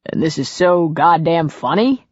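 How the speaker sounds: mildly indignant question; a touch of wounded pride. A narrator's reading. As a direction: ask it affronted, rising at the end. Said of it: "he asked indignantly".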